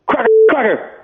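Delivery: a hint of a booming Sapp calling across the room; sing-song call, pitched high.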